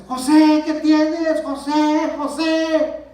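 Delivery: high, loud, alarmed shouting; panicked wife's shout, reenacted at full voice; imitating wife screaming. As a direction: yell the name as if shaking someone awake. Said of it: "mi esposa está gritándome".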